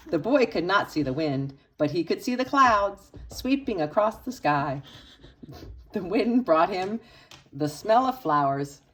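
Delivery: plain reading voice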